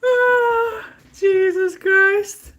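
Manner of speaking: high, breathless laughing cry, drawn out slow; gasping out the words after laughing hard; laughing fit. Real, not acted. Wiping tears after laughing at Brian, a high laughing gasp.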